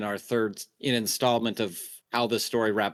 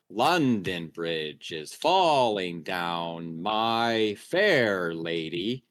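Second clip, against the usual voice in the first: slow, exaggerated singsong scansion of "London Bridge is falling down"; nursery rhyme cadence; 5 semitones lower, much slower than usual. Shown as a demonstration. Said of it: "the way that words are formed".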